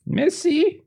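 put-on very high, slow little French girl's voice. Little girl saying good night to a guest, small high voice. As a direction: say a polite goodnight as a small French child.

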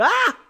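high sudden yelp of pain; a sharp cry as the branch hits; shrill yelp from a hurt wife; retold scream. Wife hit in the eye, scream.

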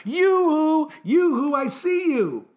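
high, loud singsong yoo-hoo; calling out. Acted. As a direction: hail someone from afar, high and playful. Story character calling out to someone.

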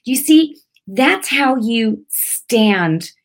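ordinary talk

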